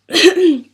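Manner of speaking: loud sudden clearing of a sore throat; sharp throat clear before going on. Real, not acted. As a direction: clear the rough throat hard. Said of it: "I've got a really bad throat".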